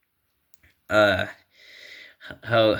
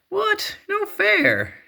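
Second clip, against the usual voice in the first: put-on very high, squeaky kid complaint; the kids protesting their punishment to Bruce; whiny protest; 3 dB louder, much slower than usual. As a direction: whine like a kid who just got grounded.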